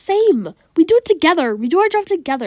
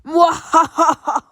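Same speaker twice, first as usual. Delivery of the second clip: villain cackle; 4 semitones higher, 3 dB louder than usual. Acted. Monster's parting laugh.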